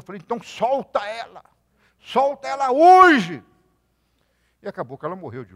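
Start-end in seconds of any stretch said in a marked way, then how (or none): 0.2-3.4 high, loud, slow command; retold shout. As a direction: bellow it as an order. Preacher shouting at the woman. (grito lento e alto: solta ela hoje)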